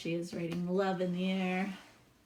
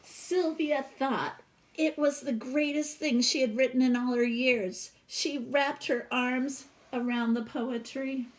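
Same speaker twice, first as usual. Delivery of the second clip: lively read-aloud storytelling voice; storybook reading; 3 semitones higher, 5 dB louder than usual. Acted. Teacher reading a picture book to her class, expressive read-aloud.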